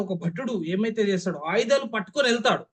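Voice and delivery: normal talking voice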